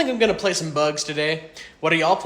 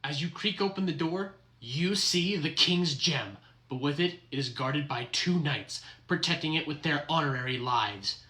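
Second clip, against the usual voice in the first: softer, measured scene setting; game master narration; 4 semitones lower, 4 dB quieter than usual. Acted. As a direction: draw the players in quietly.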